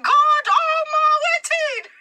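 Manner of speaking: high, loud, stretched-out panic; panicked cry. Retold or imitated. Preacher reliving terror at the sangoma grabbing the prophet. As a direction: yelp it in sheer alarm.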